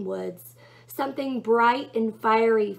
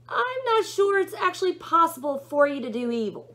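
high mock-earnest sarcasm; raised, sarcastic demon; 8 semitones higher, 3 dB louder than usual. A narrator's reading. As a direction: echo the angel's words dripping with irony. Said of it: "said Crawly sarcastically".

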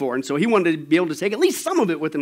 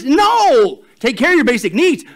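loud, high, drawn-out no; booming cry of refusal; emphatic refusal; 8 semitones higher, 9 dB louder than usual. Real, not acted. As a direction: shoot the idea down forcefully. Rejects the mock objection.

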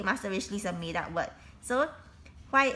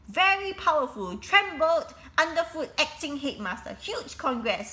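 gushing drama teacher; 6 semitones higher than usual. A narrator's reading. Drama teacher praises theatrically.

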